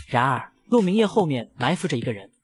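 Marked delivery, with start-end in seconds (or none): none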